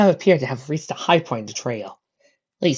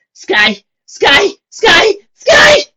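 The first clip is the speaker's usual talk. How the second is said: rising from loud to shrill, loud scream; building screams; 12 dB louder, much slower than usual. A narrator's reading. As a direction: scream it louder each time. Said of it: "The last scream of the word".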